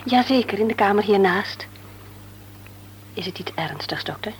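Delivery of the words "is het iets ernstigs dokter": anxious hushed whisper; worried mother whispering her fear to the doctor. Acted. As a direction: whisper it so the child won't hear. Anxious mother asks doctor quietly.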